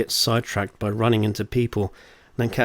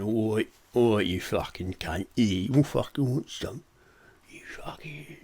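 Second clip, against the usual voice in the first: Tony voice; 5 dB quieter than usual. Pretend. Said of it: "I'm in my Tony get up".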